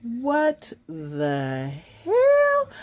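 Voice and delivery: very slow, high, drawn-out what the hell. Real, not acted. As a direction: stretch hell out in outrage. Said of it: "say it with me".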